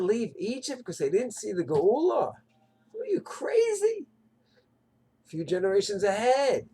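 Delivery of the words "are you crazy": incredulous. Retold or imitated. Mocking those who stayed in Egypt.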